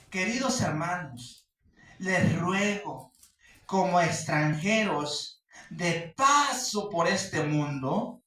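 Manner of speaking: loud, slow, pleading sermon voice; a pastor pressing each phrase home slowly; fervent preaching. Acted. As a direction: urge the congregation, slow and loud. Preaching exhortation at full force.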